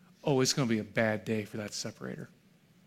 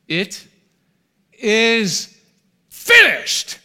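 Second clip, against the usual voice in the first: building to a huge, high, full-voiced cry; triumphant shout; 9 semitones higher, 13 dB louder, much slower than usual. Preacher proclaiming Jesus's final cry to the congregation, shouted. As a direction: roar it out as a victory cry, rising word by word. Jesus's last words on cross.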